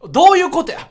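high, loud mock outcry; retold bewilderment. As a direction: exclaim it as the baffled group. (困惑して叫ぶ再現)

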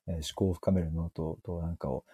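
mock a dull, mumbled first-episode intro; gloomy mumbling. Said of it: "めっちゃ暗い。". (初回収録の暗くてボソボソした話し方の真似)

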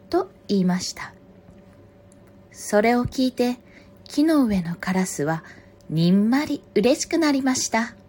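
projected storybook reading, phrase by phrase; story narration. A narrator's reading. Reader telling a fox and crow fable, story narration. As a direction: read aloud as to children, with pauses. Reads a picture book aloud.

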